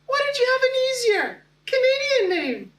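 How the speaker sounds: high-pitched mocking schoolkid; teasing classmates. Classmates needling the new kid about his name, teasing. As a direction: tease in a silly high voice. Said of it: "some of my classmates would tease".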